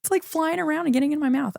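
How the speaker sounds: exasperated. Real, not acted. Complaining about hair.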